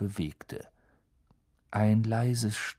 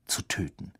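whisper; 2 semitones lower, 5 dB quieter than usual. A narrator's reading. Narration of murderous urge.

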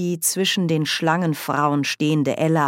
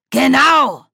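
loud, high, thundering exclamation; donnernd; 10 semitones higher, 9 dB louder than usual. A narrator's reading. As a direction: thunder it out in one burst. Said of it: "donnerte Esme". (donnernd laut ausgerufen)

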